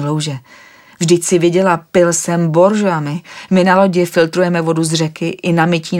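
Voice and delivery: indignant objection. Acted. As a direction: scoff at drinking pond water. Vladimir objects to pond water.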